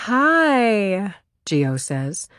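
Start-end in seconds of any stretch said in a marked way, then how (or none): 0.1-1.1 long breathy high hi; breathy valley girl. A narrator's reading. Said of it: "one long, breathy syllable, making her sound like a valley girl".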